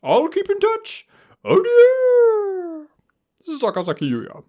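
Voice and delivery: extremely high, loud, theatrical sign-off; Yuya. Acted. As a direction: squeal the farewell, then ease down on the name. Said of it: "Yep, it's Yuya".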